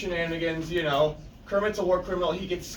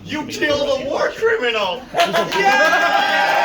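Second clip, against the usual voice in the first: excited cheer; 6 semitones higher, 6 dB louder than usual. Real, not acted. Cheering arrival of character.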